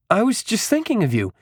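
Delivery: high, delighted-to-see-you exclamation; exclaiming. A narrator's reading. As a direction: exclaim it high, at normal volume. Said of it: "he exclaimed".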